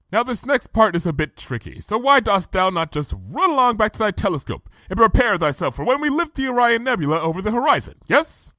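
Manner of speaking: high lofty royal voice, businesslike; brisk royal instructions; regal princess. A narrator's reading. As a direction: play the grand princess giving orders. Luna's royal voice.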